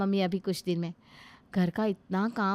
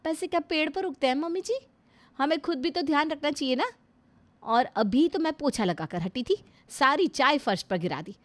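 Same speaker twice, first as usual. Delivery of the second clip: indignant rant; 5 semitones higher than usual. Acted. Daughter-in-law ranting to mother-in-law about her workload, indignant and rising. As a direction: vent every grievance at her.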